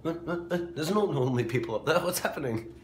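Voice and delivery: high, fast puzzled dog voice; confused dog voice. Retold or imitated. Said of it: "And Tabitha's just like".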